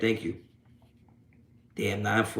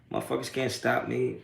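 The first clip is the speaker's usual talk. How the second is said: ordinary talk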